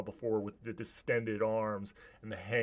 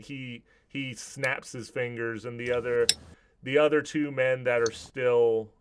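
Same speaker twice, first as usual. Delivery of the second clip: usual speaking voice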